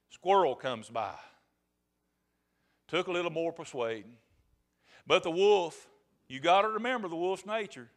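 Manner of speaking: ordinary talk, nothing marked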